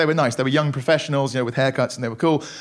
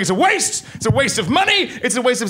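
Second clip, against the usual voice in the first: higher mock rant from the dad; 6 semitones higher than usual. Father arguing downstairs with mother, mock ranting. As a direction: play the furious dad shouting through the floor. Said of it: "he's going".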